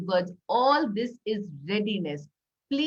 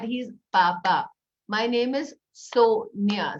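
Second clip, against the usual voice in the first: slow, each syllable separate; syllable claps; 3 dB louder, slower than usual. Shown as a demonstration. Teacher clapping syllables for a child, slow. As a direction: clap out every syllable. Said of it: "syllable clap saying".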